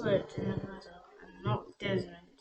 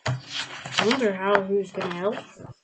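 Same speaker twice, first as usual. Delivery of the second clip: plain reading voice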